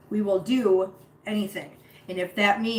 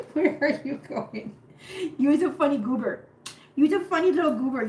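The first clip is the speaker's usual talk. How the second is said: pet talk; 6 semitones higher than usual. Real, not acted. Pet talk to kitten Nori.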